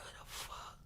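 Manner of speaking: breathless whisper of fear; whispered disbelief. Retold or imitated. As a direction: whisper it to yourself, scared. Retold gut feeling of fear.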